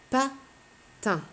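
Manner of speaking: "Patin" in two high beats, Pa, long pause, tin; split into syllables. Shown as a demonstration. Teacher presents next word to pupil, "Patin" split in two syllables. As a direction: break "Patin" apart after Pa. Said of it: "J'entends deux syllabes".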